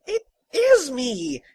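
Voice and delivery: high, slow, loud protest; drawn-out, high-pitched insistence; insistent. Acted. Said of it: "insisted the man before him".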